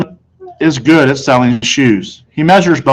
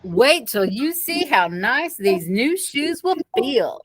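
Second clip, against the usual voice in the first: salesman coaxing; 5 semitones higher than usual. A narrator's reading. Salesman coaxing Fudge to try shoes, slow. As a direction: coax the toddler gently.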